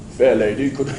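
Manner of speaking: low, courtly British gentleman; hammed-up genteel English knight; mock old-world British politeness; posh English accent. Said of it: "I can't do that accent for long".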